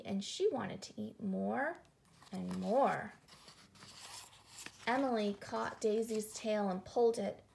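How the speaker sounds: plain reading voice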